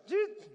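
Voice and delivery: sudden high squeak of fear; a jolt of fright, squeaked high; high startled yelp; fear's squeak. Retold or imitated. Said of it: "this fear goes".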